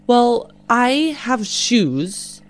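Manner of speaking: ordinary talk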